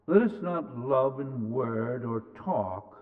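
ordinary delivery

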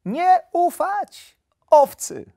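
put-on high, loud, slow childlike recital; very high, drawn-out naive voice; little hare repeating. A narrator's reading. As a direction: parrot it back as an eager little hare. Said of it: "powtórzył zajączek".

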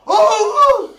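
high, loud cry at the chance; anguished shout. Real, not acted. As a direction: cry out as the shot goes. Son şans pozisyonu. (son pozisyonda acı çığlık)

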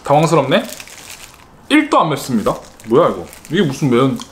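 talking while chewing; half-chewed food in mouth; mouth full. Real, not acted. Seen 0: bit chicken before; puffed cheeks, pursed lips while handling pieces.

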